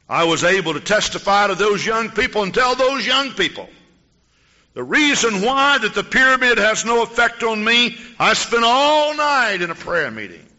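loud, high fervent preaching; preacher testifies to the congregation at full force. Acted.

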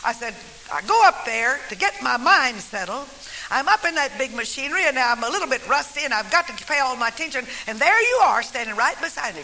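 normal talking voice

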